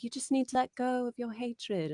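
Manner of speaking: imitating the parents. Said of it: "they were like".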